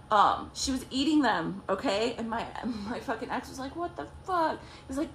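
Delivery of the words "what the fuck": retold indignation. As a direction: play his indignant disbelief in a raised pitch. Retold quarrel over fruit cups.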